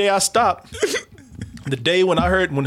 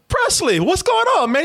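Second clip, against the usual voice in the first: cheerful greeting; 11 semitones higher than usual. Retold or imitated. Greeting blind man cheerfully.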